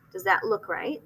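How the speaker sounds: ordinary talk, nothing marked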